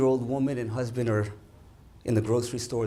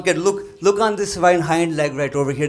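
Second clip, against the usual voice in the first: insistent father; 5 semitones higher, 9 dB louder than usual. Retold or imitated.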